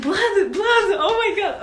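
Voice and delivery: hammed-up fright at blood; mock blood panic. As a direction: squeal over the blood, half laughing. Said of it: "I'm like".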